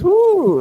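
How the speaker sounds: a loud, high-pitched mock whoop. Grilled by cops at the airport, a loud high mock whoop. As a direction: let out one sharp high woo, hammed up. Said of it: "like this".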